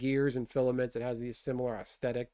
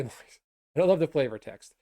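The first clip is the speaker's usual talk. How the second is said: usual speaking voice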